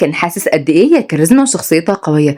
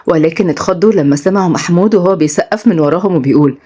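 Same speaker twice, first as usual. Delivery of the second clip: plain reading voice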